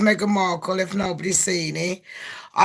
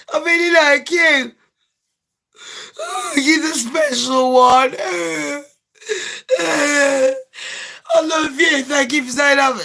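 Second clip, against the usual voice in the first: high, whimpering pillow talk; mock lovey-dovey; 5 semitones higher than usual. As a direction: gush like a smitten lover, hammed up. Acting out gushing pillow talk.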